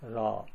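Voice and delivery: "ロ" said low-pitched as ra; "ロ" as the R syllable; R sound. Shown as a demonstration. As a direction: show "ロ" with an R, tongue held low.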